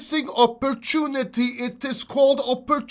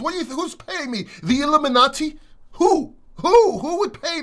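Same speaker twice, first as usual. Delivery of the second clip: incredulous; 5 semitones higher, 4 dB louder than usual. Real, not acted. Mock-indignant at paid accusations.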